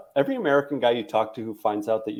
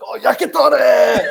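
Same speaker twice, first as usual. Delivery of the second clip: much higher, louder, laughing mock Japanese accent; 14 semitones higher, 11 dB louder than usual. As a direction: friend hamming up a Japanese accent for yakitori. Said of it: "He'd be like".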